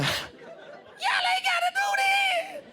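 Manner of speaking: very high, crying shriek; frantic peacemaker. Retold or imitated. Woman jumping between lovers pleads, crying high shriek. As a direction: wail it while bouncing up and down. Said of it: "Picture her just jumping".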